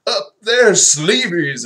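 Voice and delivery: high, shouted punchline delivery; Jerry. Acted. Friend telling a joke at a diner, hammed-up shout. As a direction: belt out a corny punchline, hammed-up.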